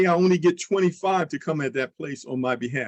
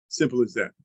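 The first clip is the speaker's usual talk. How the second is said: ordinary talk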